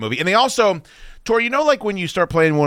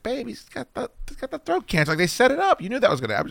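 imitated high, hushed explaining; gentle reasoning; 11 semitones higher than usual. Retold soothing of upset girlfriend.

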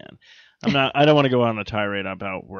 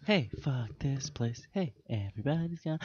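odd quiet voice; 11 dB quieter than usual. Retold or imitated. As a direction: mutter it in a weird voice.

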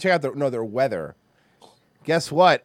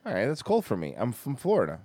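ordinary talk, nothing marked

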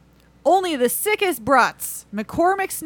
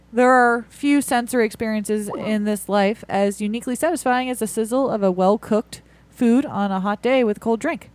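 ordinary delivery

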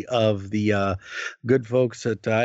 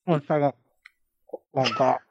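talking while chewing Peeps; mouth full; 3 semitones higher than usual. Real, not acted. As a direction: speak around a mouthful of candy. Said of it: "I'm eating".

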